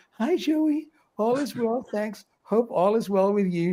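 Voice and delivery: lightly teasing, high-pitched imitation of a flirt; mock flirtatious greeting. Host voices Leanne's chat message to Joey, mock flirty. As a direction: start high and ease down, playfully mimicking flirtation. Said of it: "flirting with Joey".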